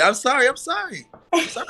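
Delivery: quick repeated high apology; high-pitched apology. Real, not acted. Late guest apologises for being muted, high-pitched apology. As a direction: pitch jumps way up to say sorry.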